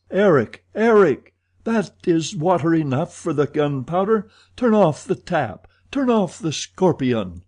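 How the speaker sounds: high, loud urgent calls; desperate shouting. A narrator's reading. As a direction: shout for him to stop the water. Trapped men shout as water rises.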